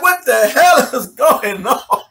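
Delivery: baffled exasperation. Retold or imitated. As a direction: drag it out in disbelief, breaking into laughter. Acted bafflement, drawn out.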